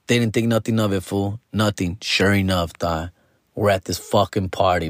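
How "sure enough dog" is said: ordinary delivery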